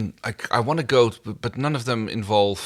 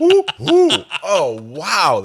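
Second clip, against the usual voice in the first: delighted squeal; 11 semitones higher, 9 dB louder, much slower than usual. Real, not acted. Reacts to cohost's song.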